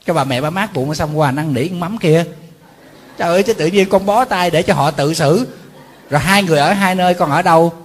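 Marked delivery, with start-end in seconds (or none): 3.2-7.7 loud mock scolding. Scolding the sons again.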